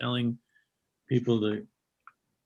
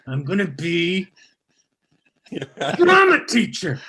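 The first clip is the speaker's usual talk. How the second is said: mock girl voice; 3 semitones higher, 8 dB louder than usual. Girl quoted at college.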